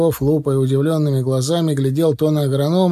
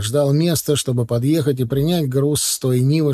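ordinary delivery

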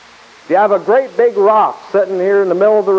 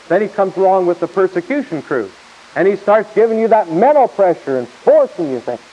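normal talking voice

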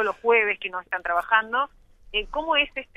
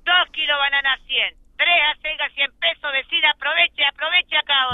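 hard sell ad; 5 semitones higher than usual. Retold or imitated. Reporter mimics market vendor cries.

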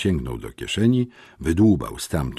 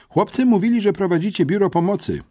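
put-on high boy voice; 13 semitones higher, 3 dB louder, faster than usual. A narrator's reading. Smerf, the younger boy, speaks.

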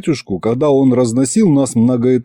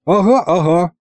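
high, knowing agreement; mildly mocking uh-huh; sceptical snicker; 8 semitones higher than usual. A narrator's reading. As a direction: humour him with disbelief. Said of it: "хмыкнул я".

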